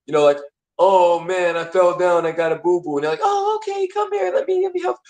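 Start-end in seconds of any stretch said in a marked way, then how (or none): 0.8-3.0 lightly acted, higher-pitched little complaint; hurt person seeking comfort. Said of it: "the person you go to when you want comfort". 3.2-5.0 put-on very high cooing baby-talk voice; doting comforter. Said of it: "the person you go to when you want comfort".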